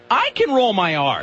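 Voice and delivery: high, slow imitation of a proud listener; mock boastful listener. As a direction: crow it like a show-off audience member. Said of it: "half the audience going".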